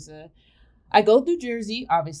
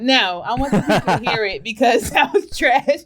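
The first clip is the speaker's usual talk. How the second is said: loud, laughing insistence; cracking up at a co-host's flop; 3 semitones higher, 7 dB louder than usual. Real, not acted. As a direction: insist through real laughter, voice raised. Laughing at co-host's failed accent.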